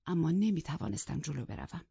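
plain reading voice

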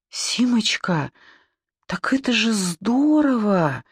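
mildly dazed, pitch lifted; bewildered babble. A narrator's reading. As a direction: say it lost and unsure, drawing it out. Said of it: "растерянно пролепетала она".

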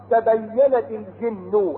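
slow chanted phrase; Quran recitation. Acted. Preacher chanting the verse about the jinn. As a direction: intone each syllable slowly.

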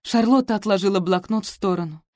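plain reading voice

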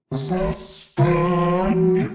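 slow, soft guttural demonic call; guttural demon cry. Acted. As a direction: summon from the depths. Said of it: "гортанно прокричал демон".

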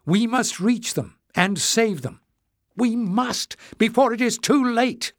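desperate plea, voice rising; trembling voice. A narrator's reading. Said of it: "Her voice trembled as she said the last words".

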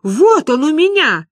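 high, loud, drawn-out protest; vehement. Acted. Люба insists she has the cheque, vehement. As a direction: throw the proof at them, stretched out. Produces cheque to prove her claim.

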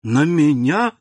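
rising, startled question; a granddaughter shocked to be the cause, surprised. A narrator's reading. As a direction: ask it back in disbelief. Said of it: "переспросила удивлённая Валентина".

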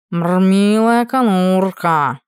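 slow purring cat voice. Acted. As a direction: purr it lazily like a cat. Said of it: "промурлыкал он".

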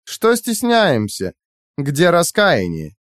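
high, slow teasing; taunting high-pitched questions; mocking prod. A narrator's reading. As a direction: chide him sweetly, drawing it out. AI prods captive to repent.

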